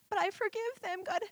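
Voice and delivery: high, soft, tearful yielding; through tears. Retold or imitated. Telling God she forgives them, tearful and soft. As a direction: give it up in a small high voice. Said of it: "So through tears, I just said".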